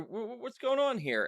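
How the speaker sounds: high, baffled stammer; mock bewildered double-take; confused. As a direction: stammer it out, thrown and puzzled. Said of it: "I was like".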